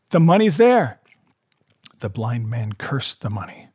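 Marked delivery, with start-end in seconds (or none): 0.1-1.0 called reply. A narrator's reading. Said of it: "he cried".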